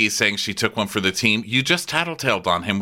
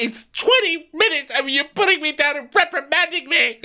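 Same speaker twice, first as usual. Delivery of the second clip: very high-pitched hammed-up complaint; mock indignant outburst. Housewife protesting being reprimanded, mock-hysterical. Acted quarrel, loses her mind.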